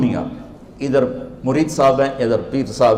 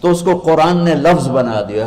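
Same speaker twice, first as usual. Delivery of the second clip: ordinary talk, nothing marked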